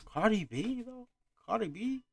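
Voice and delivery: rough imitation of a Bronx accent. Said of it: "I'm trying to make her an accent".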